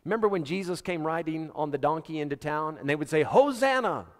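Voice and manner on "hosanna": raised, loud cry of praise; excited acclamation. Acted. Crowd greeting Jesus riding into town, excited shout. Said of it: "I'm excited about this".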